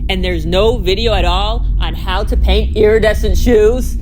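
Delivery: loud, incredulous rant; worked-up outrage. Acted. Vlogger finds no tutorial on painting shoes, loud exasperation. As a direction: raise your voice in disbelief. But I had to calm down.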